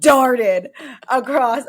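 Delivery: laughing loudly through the words; can't stop laughing as you tell it; high, loud laughing retelling. Real, not acted. Laughing while retelling escape.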